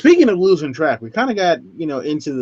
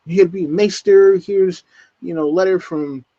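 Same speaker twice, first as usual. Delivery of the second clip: eager Sam; 5 semitones higher, 3 dB louder than usual. Retold or imitated. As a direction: announce yourself hopefully, a bit nervous. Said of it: "he's like".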